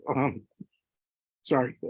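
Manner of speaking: real emotional catch in the voice; fighting to regain composure; choked up. Real, not acted. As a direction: apologize while overcome with emotion.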